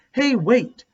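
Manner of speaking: high, loud surprised call; sudden realization. Acted. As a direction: stop her mid-thought.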